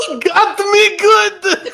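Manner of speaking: laughing delight. Acted. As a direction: cry it out through laughter, caught out and loving it.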